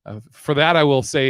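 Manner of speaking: ordinary talk, nothing marked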